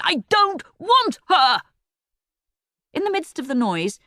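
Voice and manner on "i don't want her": loud, slow furious shout. A narrator's reading. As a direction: yell it at her. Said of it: "he shouted".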